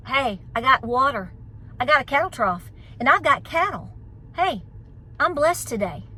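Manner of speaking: normal talking voice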